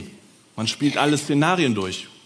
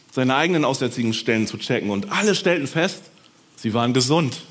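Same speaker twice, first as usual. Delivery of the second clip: ordinary delivery, nothing marked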